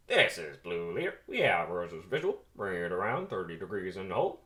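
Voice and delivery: lightly voiced squadron leader; a hint of cockpit radio call; pilot radio voice. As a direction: talk like a fighter pilot on radio.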